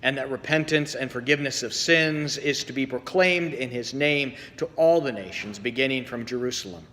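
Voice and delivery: measured, solemn liturgical reading; gospel reading. Acted. Pastor reading the gospel to the congregation, solemn and measured. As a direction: proclaim the scripture to the congregation. Reads the gospel lesson aloud.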